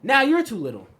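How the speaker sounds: high, loud mock concession; acted comeback. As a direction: agree loudly, then turn the taunt back. Acting out comeback to imagined bully.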